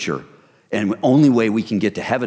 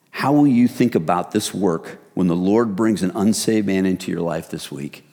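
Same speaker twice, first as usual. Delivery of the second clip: ordinary talk, nothing marked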